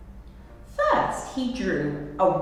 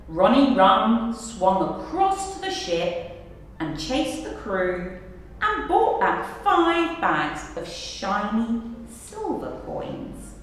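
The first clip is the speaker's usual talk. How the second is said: ordinary delivery, nothing marked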